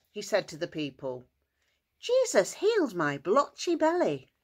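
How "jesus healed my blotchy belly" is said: excited. Acted. Healed man shows off to people.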